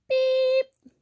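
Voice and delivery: high, loud little truck beep; truck horn beep. A narrator's reading. Blue greets the toad, high beep. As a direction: beep like a small cheerful horn. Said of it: "Blue said".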